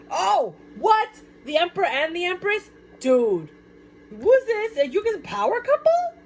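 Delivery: high, loud astonished squeals; mock astonished onlooker. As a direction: act floored by the power couple. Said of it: "You feel like".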